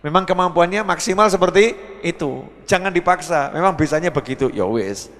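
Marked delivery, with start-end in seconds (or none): none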